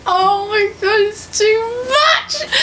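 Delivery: very high, tearful squeal; overwrought wailing. Real, not acted. Fan overcome by her own sad ending, tearful wail.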